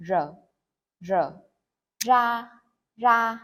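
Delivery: r and ra with a rolled southern r. Shown as a demonstration. Teacher shows how southerners say ra, clear. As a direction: roll the r in ra. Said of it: "some people might pronounce the R".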